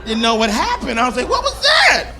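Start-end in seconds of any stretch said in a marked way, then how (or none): 1.3-2.1 high, loud startled question; acted high-pitched alarm. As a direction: react in shock at your own voice. Startled by own cracking voice.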